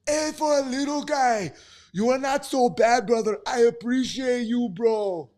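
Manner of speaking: loud, high-pitched impersonation of a fighter; Yoel Romero impression. Streamer rereading a viewer's comment, loud high impression. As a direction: put on a voice far above your own and belt it out. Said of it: "doing it in the Yoel Romero voice".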